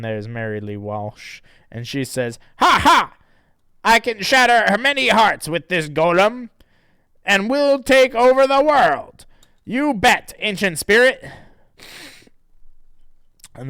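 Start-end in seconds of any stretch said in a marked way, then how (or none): 2.6-11.2 loud, high hammed-up evil gloating; high villain witch voice.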